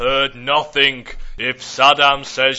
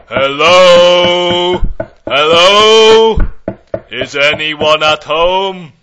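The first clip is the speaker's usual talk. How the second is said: calling out; 3 semitones higher, 11 dB louder, slower than usual. Acted. Calling out at empty house.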